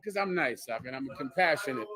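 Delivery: soft, low, matter-of-fact; understated boast. Pretend. Telling Doc why he gives, soft modest boast. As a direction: drop it low and quiet. First take, before asked to repeat.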